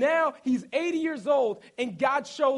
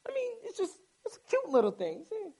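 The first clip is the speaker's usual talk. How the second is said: a hint of a doting princess; high cooing daughter; 10 semitones higher, faster than usual. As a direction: coo over the baby in a high voice. Pharaoh's daughter voiced over baby.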